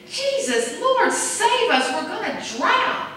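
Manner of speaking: high, slow cry for rescue; desperate plea. Retold or imitated. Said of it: "they said".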